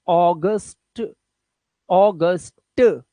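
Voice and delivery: अगस्त as a slow AW-gust, final t released; drawn-out "AW-gust-uh", syllable by syllable; English pronunciation. Shown as a demonstration. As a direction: model English August slowly for learners. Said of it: "तो इसे ओ बोलना है".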